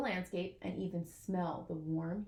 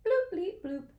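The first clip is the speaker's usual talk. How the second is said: high, loud electronic bloop-bleep imitation; beeping watch sound; 10 semitones higher, 5 dB louder, slower than usual. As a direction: chirp it out like a wristwatch alarm.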